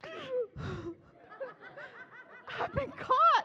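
high, loud squeal of alarm; mock panic. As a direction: squeak it out like a kid caught red-handed. Panicked reaction caught buying lottery.

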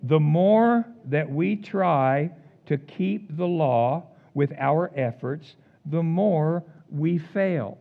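drawn out slow, each word given weight; measured, emphatic sermon line; slow, weighty statement. Acted. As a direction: lay it down slowly like a verdict. Preacher states his key line.

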